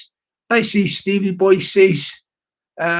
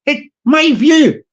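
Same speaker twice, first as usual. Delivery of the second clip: higher, swooping mock Irish accent; hammed-up South Dublin Irish, pitched up; 3 semitones higher than usual. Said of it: "get the tone right".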